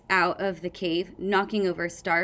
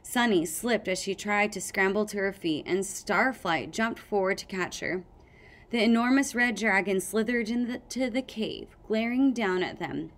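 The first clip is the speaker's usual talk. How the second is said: plain reading voice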